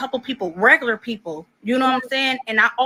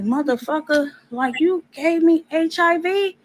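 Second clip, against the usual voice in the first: outraged accusation; 8 semitones higher than usual. Retold or imitated. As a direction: throw the accusation at him, pitch climbing. Confronting boyfriend over HIV, retold.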